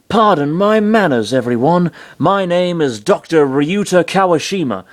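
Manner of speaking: high, put-on introduction; cheery doctor character. A narrator's reading. Floating head introduces himself to everyone, high cheery voice. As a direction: introduce yourself in a lifted, eager voice.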